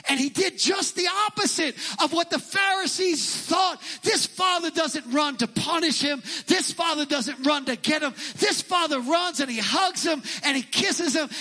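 preaching at full force. Real, not acted. Preacher rising to a shout about the running father. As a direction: hammer each line home to the congregation.

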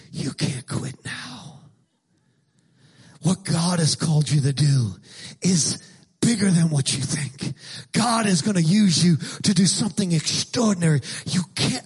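low, hushed, weighty imitation of a dramatic preacher. Pastor imitating his friend's dramatic preaching voice, low and solemn. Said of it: "when you hear him talk like that".

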